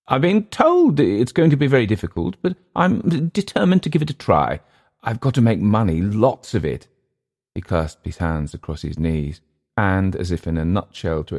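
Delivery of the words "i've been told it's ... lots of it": high, earnest character voice; determined, nervous Englishman. Acted. Englishman defending his jungle expedition to his host, high and earnest. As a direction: insist earnestly, pitched high.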